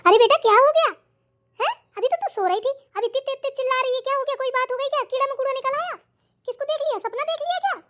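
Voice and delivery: a mother rushing in, worried; fussing mother-in-law. Acted. Mother-in-law soothing her daughter. (चिंतित सास की ऊँची आवाज़)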